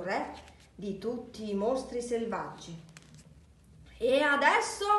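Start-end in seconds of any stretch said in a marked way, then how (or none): 4.0-4.9 high, loud rallying cry; wild yell. A narrator's reading. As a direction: rouse the monsters with a shout. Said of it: "urlò Max".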